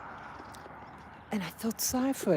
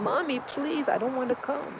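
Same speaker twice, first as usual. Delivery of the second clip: higher pleading child's voice, lightly mocked; 7 semitones higher than usual. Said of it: "They sound like children saying".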